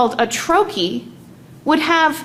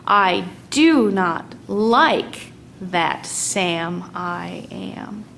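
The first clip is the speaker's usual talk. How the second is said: slow poem reading; 2 semitones lower, much slower than usual. Shown as a demonstration. Teacher reading the poem to the class, slow and rhythmic. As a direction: read the lines slowly, leaning on each beat. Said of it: "let's see which kinds of stresses".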